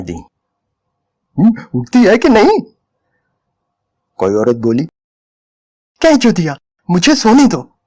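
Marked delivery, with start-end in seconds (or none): 1.4-2.6 loud, higher barked demand; harsh bark. A narrator's reading. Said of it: "दलाल की बहुत जोर की कड़क". 6.0-7.8 choked voice. A narrator's reading. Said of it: "उसकी आवाज घुटी घुटी सी थी".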